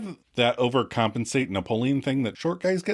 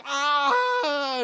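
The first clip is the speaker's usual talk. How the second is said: much higher yelp of pain; mock holler of pain. Katie hollering after Rachel kicks her shin, high yelp. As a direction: yelp like you were kicked in the shin. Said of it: "And Katie hollers".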